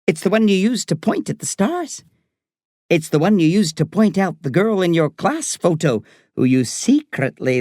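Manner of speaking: high, lilting Irish doctor; Irish accent. A narrator's reading. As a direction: build a playful list for a child in a high Irish accent. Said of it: "I love her Irish accent".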